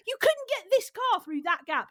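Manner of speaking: hammed-up disbelief at the driver; mock exasperated shout. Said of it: "He was like".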